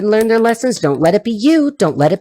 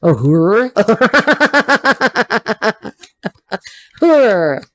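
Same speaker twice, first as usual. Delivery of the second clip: cheeky laughing; much slower than usual. Real, not acted.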